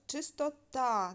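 чистота with a long, strong final ТА; чистоТА, final syllable stretched; stress on last syllable. Shown as a demonstration. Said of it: "ненаголошений і наголошений звук".

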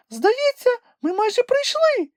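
very high, squeaky little creature voice; a tiny animal piping up in excitement; Kulka exclaiming. A narrator's reading. Kulka tells Grampus they are almost there, squeaky excited cry. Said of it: "вигукнула вона".

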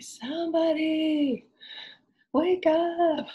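high, slow, hammed-up calling voice; a playful cry to wake everyone; mock wake-up call. Speaker voicing her own aching, high playful wake-up call.

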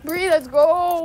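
loud slow calling out. Real, not acted. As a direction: call her over, drawn out. Calling a friend to follow.

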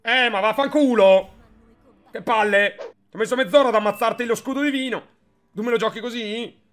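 furious outburst at the game; loud, high angry rant; explosive italian cursing. Real, not acted. Streamer angry at opponent's play. (sfogo furioso imprecando)

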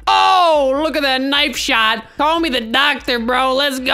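loud, high opening whoop; excited cheer. Real, not acted. Celebrates a knife shot.

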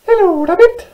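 very high, squeaky tiny mouse voice; little mouse. Acted. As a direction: exclaim in a loud mouse squeak. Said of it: "she said".

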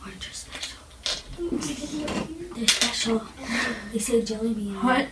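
hushed whisper; quiet secretive aside. Real, not acted.

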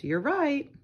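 usual speaking voice

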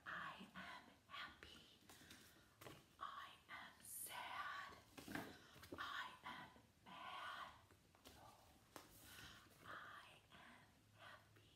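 slow whispered prompts. Shown as a demonstration. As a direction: whisper the answers to cue him. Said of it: "And then I will start".

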